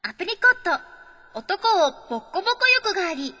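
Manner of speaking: high, lively announcer read; dramatic letter reading. Acted. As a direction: read it out like a big reveal. Reads listener letter.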